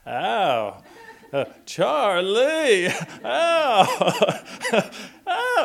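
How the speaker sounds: high, loud mock wail of pain; bitten little boy. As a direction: draw the name out in a hammed-up child's howl. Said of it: "And he does".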